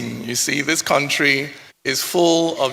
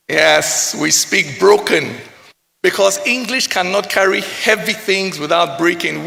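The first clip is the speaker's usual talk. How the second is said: normal talking voice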